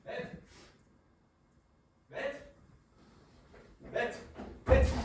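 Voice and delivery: calling from offstage. Acted. Assistant calling from afar.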